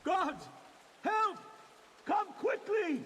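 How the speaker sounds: frantic high yells for help; shouting for help. Acted. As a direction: cry out for help. Game character cries for help.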